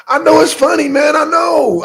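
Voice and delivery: high, loud, giggly admission; loud laughing protest. Real, not acted. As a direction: own up loudly through the laughter. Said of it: "I know I'm laughing".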